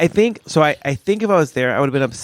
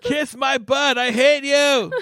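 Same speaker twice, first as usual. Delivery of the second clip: high, strained pretend rant; hammed-up meltdown, pitched way up; mock priest freak-out; 12 semitones higher, much slower than usual. Priest freaking out walking down the street, mock furious. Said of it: "one day I just freak out as a priest".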